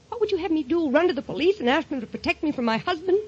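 building, rising exasperation; desperate protest. Acted. Wife pleads with threatening husband.

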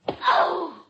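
loud, high cry of pain; wounded shout. Acted. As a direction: cry out as the blade goes in. Woman is stabbed in struggle.

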